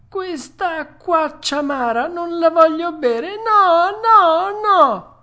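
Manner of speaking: high, loud tantrum wail; tearful outburst. Acted. Puppet refusing the bitter water, loud tearful wail. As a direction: build to shrieked refusals, as if bursting into tears. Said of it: "dando in uno scoppio di pianto".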